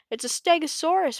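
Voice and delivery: high, smug announcement; Bonehead declaring. A narrator's reading. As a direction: laugh off their fear. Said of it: "Bonehead declared".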